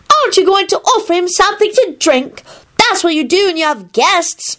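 put-on high, prim little-kid scolding; righteous child. A narrator's reading. Teddy reminds Neville about guests, righteous. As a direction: scold high like a small stickler for manners. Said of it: "he told himself righteously".

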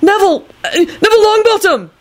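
high-pitched nervous-sounding call of a name. Acted. Neville calling out who he is, high-pitched call. Caller announcing himself at the door.

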